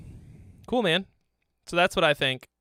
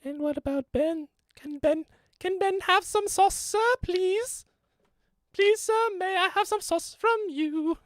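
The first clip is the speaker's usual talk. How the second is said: much higher, thin mock child voice; squeaky begging viewer. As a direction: beg for sauce like a little orphan. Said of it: "Ben's like".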